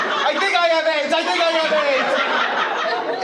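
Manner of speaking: put-on high, loud panicked kid's wail. Terrified schoolkid running to the nurse's office in a high panic. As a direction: shriek it like a kid who thinks he's doomed.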